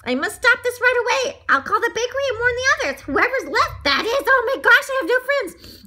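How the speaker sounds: high, loud, frantic little voice; panicking donut character. A narrator's reading. Donut fears his friends were eaten, panicking. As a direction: fret aloud in a panicked squeal.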